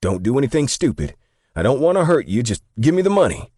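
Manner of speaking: high, urgent yell that speeds up at the end; shouting. A narrator's reading. Young robber demanding money from the clerk, shouting. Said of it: "the guy shouted".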